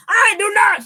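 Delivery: high, loud and defiant; hammed-up mom denying the cop; mock indignant shout. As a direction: snap back like a mom caught speeding. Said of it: "She's like".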